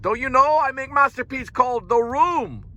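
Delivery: high, odd put-on accent; hammed-up Wiseau voice; Tommy Wiseau impression. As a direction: boast about the masterpiece like Wiseau. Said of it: "It sounds like Tommy Wiseau".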